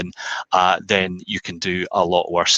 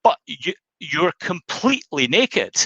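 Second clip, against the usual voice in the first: baffled husband; 8 semitones higher than usual. Acted. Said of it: "the husband said".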